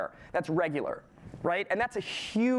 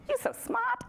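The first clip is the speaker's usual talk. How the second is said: much higher, teasing sing-song compliment; mock praise; slower than usual. Lecturer teasing a student who answered, mock high praise. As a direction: tease the student with exaggerated praise.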